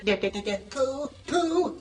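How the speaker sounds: small, soft stammer; Piglet. Acted. Timid friend comes up to Pooh, softly stammering. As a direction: stammer softly toward the name. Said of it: "and Piglet said".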